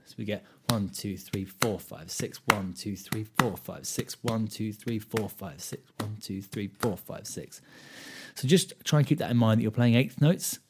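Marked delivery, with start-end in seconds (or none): 0.7-7.6 a teacher counting six eight with a strong one; count six eight, accent on one. Shown as a demonstration. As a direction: punch each one, breathe the others. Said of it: "lends itself to the shuffle feel".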